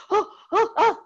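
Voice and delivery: loud, very high mimicked gasps; hot-pepper gasps. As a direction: gasp as if the mouth is burning. Mimics friend's first hot pepper.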